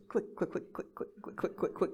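whispered, voiceless rapid clicks; tiny hushed click sounds in quick succession; imitated clicking. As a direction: imitate safety catches clicking under your breath. Imitating safeties clicking off in the dark.

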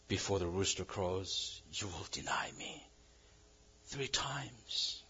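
soft whisper of remembered words; whispered memory. Retold or imitated. Peter recalling Jesus's warning, a faint whisper. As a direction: breathe the prophecy faintly.

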